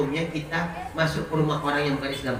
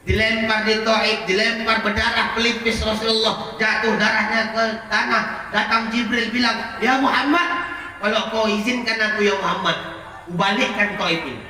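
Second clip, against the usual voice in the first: rising preaching; 6 semitones higher than usual. Acted. Preacher voicing Jibril's offer, raised. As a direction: push the Taif story upward.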